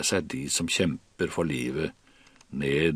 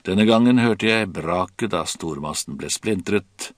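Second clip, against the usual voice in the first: ordinary delivery, nothing marked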